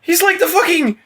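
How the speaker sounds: bewildered ranting through laughs; agitated. Real, not acted. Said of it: "You're getting, like, agitated again".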